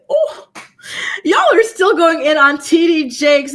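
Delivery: loud, high burst of disbelief; surprised outburst. Real, not acted. Streamer shocked at her chat still on T D Jakes, loud surprised outburst. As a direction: blurt it out, caught off guard. Reading live chat, exclaiming.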